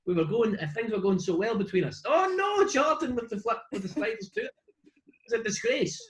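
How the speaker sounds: high, outraged scolding; mock outrage. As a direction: scold them in mock horror. Mock outrage at Jessica, Jordan.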